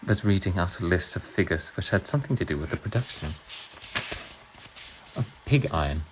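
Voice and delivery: measured put-on British narration; British accent. Streamer reading Orwell to viewers in a British accent. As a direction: read the novel aloud with a British accent. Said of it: "I'll do it in a British accent".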